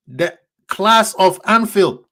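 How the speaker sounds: normal talking voice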